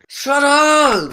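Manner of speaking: indignant shout. Real, not acted. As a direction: shout them down, flustered. Friend yelling after being teased.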